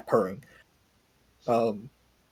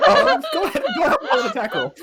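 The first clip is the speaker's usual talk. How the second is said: words squeezed out through high laughter; barely getting it out while cracking up; laughing; 6 dB louder, faster than usual. Real, not acted. Game master to player attempting a dropkick, laughing hard. Dropkick declared, table erupts.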